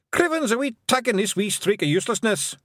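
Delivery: high, broad Scottish pixie; scornful wee Scotsman; broad scottish accent. Acted. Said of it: "said Rob".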